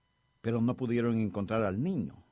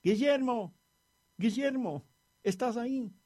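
put-on high, excited calling out for a lost child; grito emocionado; 10 semitones higher than usual. A narrator's reading. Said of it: "con el corazón acelerado por la emoción gritó". (grito agudo y emocionado, llamando)

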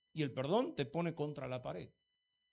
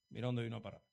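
ordinary delivery

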